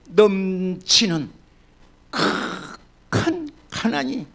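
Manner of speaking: drawn-out, very slow words; slow emphasis. Acted. Pastor to congregation, slowly stressing the extreme poverty. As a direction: stretch each syllable for weight. Preacher drawing out key words.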